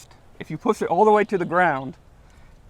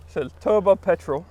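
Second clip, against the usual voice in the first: fake British accent. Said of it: "your best British accent".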